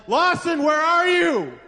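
petrified parent's high, loud call; high, urgent holler; crying out. Acted. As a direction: shout desperately for a lost child. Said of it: "I'm crying out to him".

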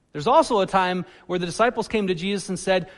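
ordinary delivery

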